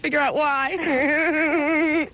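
drawn-out mock sultry voice; crazy voice. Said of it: "Wow, that was a crazy voice".

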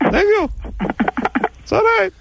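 very high squeaky puppet voice; ferret voice. Pretend. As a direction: squeak it like a tiny ventriloquist dummy. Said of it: "the vagina wants to say something".

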